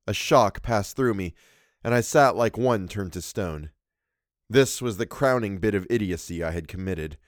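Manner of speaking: usual speaking voice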